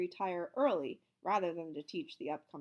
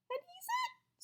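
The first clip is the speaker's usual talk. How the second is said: very high frightened squeak; slower than usual. A narrator's reading. Scared teacher squeaking about the new one, very high. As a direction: squeak it out in terror. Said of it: "squeaked Mister Jupiter".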